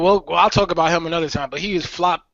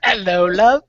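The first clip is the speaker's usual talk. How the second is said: put-on British accent; 3 semitones higher, slower than usual. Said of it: "He got that accent".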